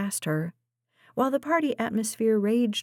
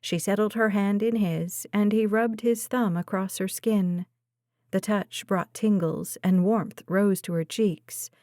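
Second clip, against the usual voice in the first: ordinary delivery, nothing marked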